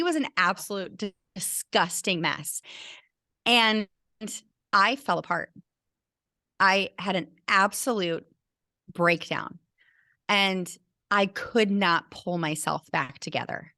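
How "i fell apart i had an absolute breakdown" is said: ordinary talk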